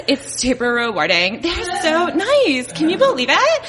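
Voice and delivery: put-on gushing Valley Girl, pitch leaping up by the end. Stanford graduate starting at Google, gushing Valley Girl voice. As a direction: gush like an excited Valley Girl. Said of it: "what about the Valley Girl version of me".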